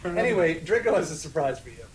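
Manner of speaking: ordinary talk, nothing marked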